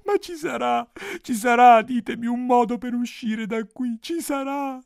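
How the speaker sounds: high-pitched princess pleading; hammed-up begging princess; insistent princess. A narrator's reading. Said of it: "insisteva ogni notte la principessa".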